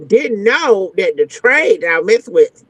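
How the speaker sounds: usual speaking voice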